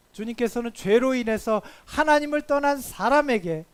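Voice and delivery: loud, high, emphatic sermon delivery; forceful preaching. Real, not acted. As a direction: proclaim it to the congregation at full force. (힘주어 크게 선포하는 설교)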